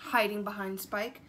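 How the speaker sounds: plain reading voice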